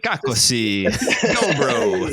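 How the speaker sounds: Croatian in a hammed-up Italian accent; strong Italian accent. Shown as a demonstration. Joking how they spoke Croatian on the coast, strong Italian accent. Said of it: "Croatian in the strongest Italian".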